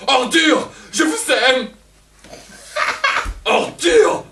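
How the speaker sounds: high loud cries mixed with laughter; hysterical cries. Acted. Elvire raging at Don Juan, with high hysterical cries. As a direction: break down in loud wild cries.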